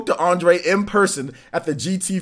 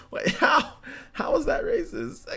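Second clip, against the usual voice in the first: laughing; 13 semitones higher, 4 dB quieter than usual. Real, not acted. Laughing in disbelief.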